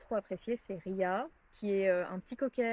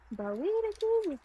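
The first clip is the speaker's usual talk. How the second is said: high voice for animals; 12 semitones higher than usual. Real, not acted. Talking softly to the lambs.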